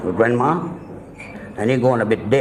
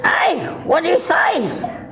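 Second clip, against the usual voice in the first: high, drawn-out puzzled question; deaf grandfather, high and slow; much slower than usual. Retold or imitated. Deaf grandfather asking grandma again, high and slow. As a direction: stretch it out like he can't hear.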